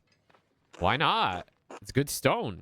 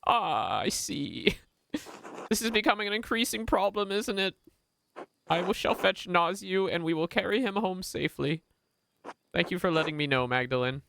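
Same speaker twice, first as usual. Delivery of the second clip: put-on much higher, prim and polite old tradesman; a sharply higher, precise voice for a fussy craftsman; 12 semitones higher than usual. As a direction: play the busy, fastidious master brewer. Voices Baltus character.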